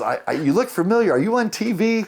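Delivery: high imitation of someone piecing it together; curious stranger. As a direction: pitch climbs as recognition dawns. Retold stranger recognizing him.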